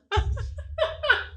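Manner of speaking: high-pitched soft giggle. Real, not acted. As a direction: giggle lightly. Giggle after compliment.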